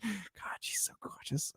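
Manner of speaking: hushed, awestruck inner voice; smitten whisper. Acted. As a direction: whisper it to yourself, besotted. Inner thought seeing a girl.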